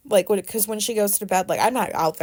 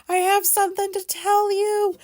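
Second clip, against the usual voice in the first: nervous confession; 11 semitones higher, 3 dB louder than usual. Retold or imitated.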